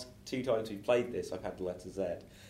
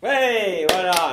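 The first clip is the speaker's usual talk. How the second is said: loud, bright congratulations; loud cheer; 6 semitones higher, 9 dB louder, much slower than usual. Real, not acted. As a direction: cheer them on loudly. Host cheers the performer.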